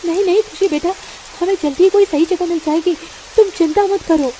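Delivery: calm her fears; mother comforting her frightened daughter; reassuring mother. Acted.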